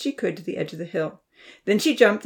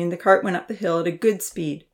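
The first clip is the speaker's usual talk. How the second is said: ordinary delivery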